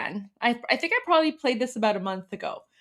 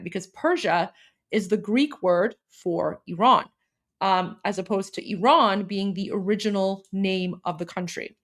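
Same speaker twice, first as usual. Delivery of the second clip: ordinary talk, nothing marked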